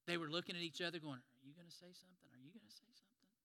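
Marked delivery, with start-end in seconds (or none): 1.2-2.3 much softer muttered nudge; murmured aside. Acted. Disciples nudging each other. 2.3-3.3 much softer whispered nudge. Acted. Disciples nudging each other.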